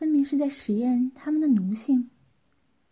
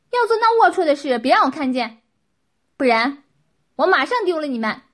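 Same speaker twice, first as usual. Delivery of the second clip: loud, higher-pitched commanding rebuke; stern dressing-down; 4 semitones higher, 10 dB louder than usual. A narrator's reading. Qin orders the pair to behave, loud stern scolding. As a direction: lay down the law at full volume. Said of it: "秦训道".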